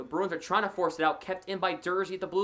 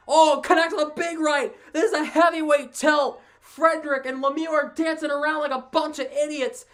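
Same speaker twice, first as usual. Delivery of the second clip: loud, higher, urgent live hockey call; excited fight play-by-play; 5 semitones higher, 6 dB louder than usual. Real, not acted. Commentator calling Lemieux and Frederic's fight, excited loud play-by-play. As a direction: punch out each blow as it lands.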